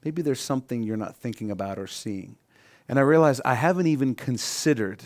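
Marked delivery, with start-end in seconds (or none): 0.0-2.4 soft, gentle nudge; much softer, coaxing question; quietly prompting him to look deeper; gentle Holy Spirit voice. Retold or imitated. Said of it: "the Holy Spirit just says".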